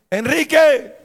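calling Enrique at the top of his voice, high; shouting the friend's name from the next room; retold shout. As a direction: yell a name across the house. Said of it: "él me gritó desde acá".

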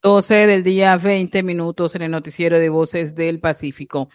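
crisp radio news time call; bright radio bulletin voice; news anchor read. Real, not acted. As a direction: announce the hour like a newscaster.

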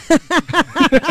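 loud high laugh; high burst of laughter. Real, not acted. Host laughing at joke vote.

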